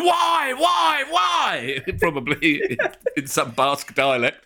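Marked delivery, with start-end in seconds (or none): 0.0-1.8 anguished wail. Retold or imitated. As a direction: cry out to the heavens. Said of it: "He's on the ground going".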